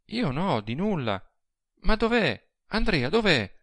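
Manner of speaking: high, anxious woman's questions; distressed little lover, high-voiced; anguished. A narrator's reading. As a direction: ask in anguish, pitch swooping high. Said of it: "la domanda angosciosa della piccola amante".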